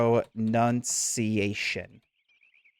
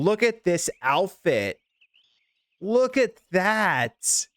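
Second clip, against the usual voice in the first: awed admiration; 7 semitones higher, much slower than usual. Real, not acted. Admiring outfit, drawn out.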